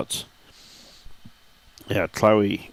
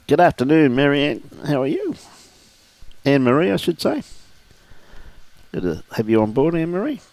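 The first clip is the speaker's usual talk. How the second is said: cheerful greeting; 6 semitones higher than usual. Real, not acted. Greeting a viewer on air.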